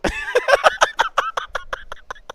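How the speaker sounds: high wheezing laugh; real laughing fit. Real, not acted. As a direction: crack up helplessly at your own story. Cracks up at own story.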